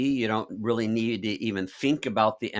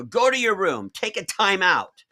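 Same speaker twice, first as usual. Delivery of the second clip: mock stern parent, high and loud; 8 semitones higher, 5 dB louder than usual. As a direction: scold the whole planet like a parent. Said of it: "like God sending the entire planet to their room".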